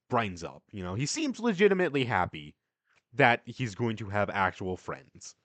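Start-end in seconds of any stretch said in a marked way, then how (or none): none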